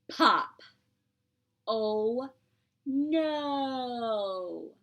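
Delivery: loud startled oh no; dismayed oh no. Acted. Teacher reacting to the last button popping. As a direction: cry out in mock dismay.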